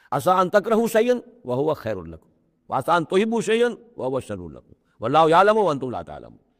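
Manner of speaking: intoned Quran verse, rising and measured; Quranic recitation. Acted. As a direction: recite the verse in tajweed cadence. Quran verse recited within lecture.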